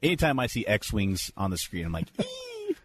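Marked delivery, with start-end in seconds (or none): 2.2-2.8 tiny, very high-pitched fan squeal; geeky squee. Retold or imitated. Fan reacting to X-Wings on screen, geeky squee. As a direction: let out a little squee, high and soft. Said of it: "give a little geeky squee".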